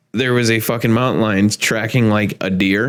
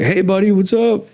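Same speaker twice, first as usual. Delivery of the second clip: lightly voiced brother, higher and chummy; friendly greeting; 8 semitones higher than usual. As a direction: imitate the brother's cheery hello, pitched up.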